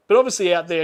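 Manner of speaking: ordinary talk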